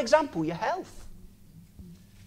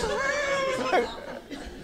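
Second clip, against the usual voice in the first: a high trailing laugh; 5 dB quieter than usual. Real, not acted. Still amused by the advert, high trailing laugh. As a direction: laugh that fades out.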